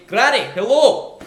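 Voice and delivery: booming, high Glaswegian; performed Glasgow accent, big and loud. Shown as a demonstration. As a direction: belt it out in broad Glaswegian. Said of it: "I start speaking like this".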